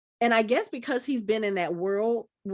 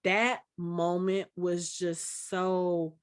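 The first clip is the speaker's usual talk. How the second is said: slow and halting, close to tears; tearing up; slower than usual. Real, not acted. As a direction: fight back real tears as you speak. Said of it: "I'm starting to tear up now".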